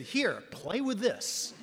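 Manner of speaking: slow, amused handing over a toy; lightly voiced Jim, chuckling; slow, laughing mock Jim; imitating Jim, laughing. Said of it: "He saw me and he said".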